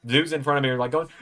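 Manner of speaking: normal talking voice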